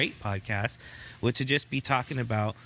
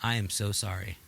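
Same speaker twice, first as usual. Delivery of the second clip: a husband apologising to his wife in tears; husband apologising to his crying wife, softly and sincerely; sincere apology; 6 semitones lower, 3 dB quieter than usual. Retold or imitated. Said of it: "see your wife in tears and be like".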